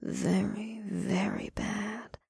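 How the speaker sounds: slow breathy whisper, teasing; breathy purring seductress. Acted. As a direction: purr it like a seductress. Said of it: "The succubus purred".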